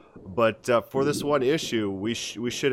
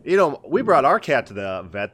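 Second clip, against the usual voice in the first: ordinary talk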